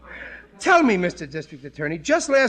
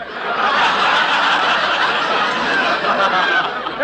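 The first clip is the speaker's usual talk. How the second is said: relieved laugh; 3 semitones higher, 5 dB louder than usual. Acted. Relieved laugh after reading.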